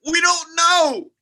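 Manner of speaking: high, loud and slowed, bursting with excitement; excited outburst. Acted. To his friend about the UFO, an excited loud outburst. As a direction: blurt it out, thrilled and baffled.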